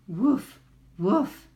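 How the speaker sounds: loud, abrupt dog woofs; startled dog. A narrator's reading. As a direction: bark the word out like a startled dog. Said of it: "said the startled dog".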